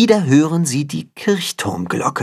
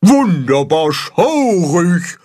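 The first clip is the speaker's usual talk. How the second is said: slow, high, stretched-out exclamation; admiring call; 6 semitones higher, slower than usual. A narrator's reading. The carp applaud the roar, calling out slowly and high. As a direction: draw the words out in high delight. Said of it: "rufen die Karpfen".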